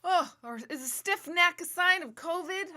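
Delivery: high, loud alarmed yelp; mock panic. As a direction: gasp it like a hypochondriac spotting a symptom. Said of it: "Or I'll be like".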